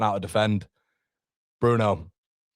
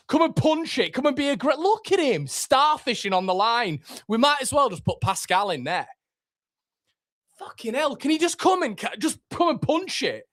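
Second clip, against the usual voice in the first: angry shout; 12 semitones higher than usual. Real, not acted. Fan yelling at the goalkeeper during a corner, furious high shout. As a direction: shout your frustration at the screen.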